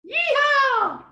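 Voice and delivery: high, loud celebratory holler; triumphant cheer, pitch soaring; jubilant whoop. Real, not acted. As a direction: whoop it out like a cowgirl. Celebrates end of her testimony.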